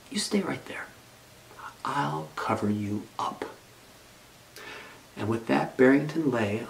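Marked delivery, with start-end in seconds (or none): 0.1-3.4 soft whispered comfort; tender whisper. A narrator's reading. Bunny whispering to the shivering mouse, tender whisper. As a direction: whisper gently as you tuck him in.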